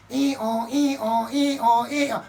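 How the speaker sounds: rising sung siren wail; police siren imitation. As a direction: copy the siren, climbing.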